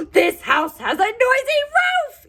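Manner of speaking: high, loud exasperated yell. Acted. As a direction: yell at the noisy roof as Owl. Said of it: "yelled Owl".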